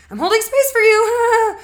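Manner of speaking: shrill, strained yell through clenched patience; frustrated, forced patience. Retold or imitated. Frustrated partner snapping that she's holding space. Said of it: "you're kind of frustrated, and you're like".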